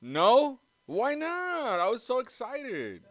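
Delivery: high, loud mock outrage at the insult; hammed-up offended squeal. Pretend hurt reaction to insult.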